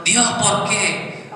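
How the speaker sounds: high, slow anguished cry to God; patient in pain crying out to God, slow and high; mock anguished cry. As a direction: cry out to the heavens, drawn out. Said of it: "yo no estaba gritando".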